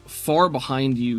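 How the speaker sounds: normal talking voice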